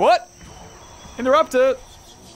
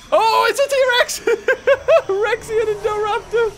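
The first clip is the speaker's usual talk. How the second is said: excited squeal; 12 semitones higher, 3 dB louder than usual. Real, not acted. Dinosaur fight in game.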